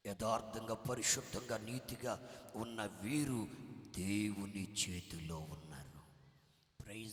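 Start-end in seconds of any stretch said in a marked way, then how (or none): 0.1-5.7 loud preaching, slowing at the end; full-force preaching. Real, not acted. Preacher's climax to the congregation, loud forceful preaching. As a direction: drive the point home with force.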